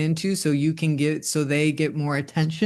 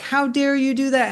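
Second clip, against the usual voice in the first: much higher, indignant mock outrage; outraged mom; 10 semitones higher than usual. Mom scolding her cursing daughter, mock outrage. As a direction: play the furious mother, hammed up. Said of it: "my mom would be like".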